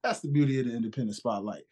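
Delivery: ordinary talk, nothing marked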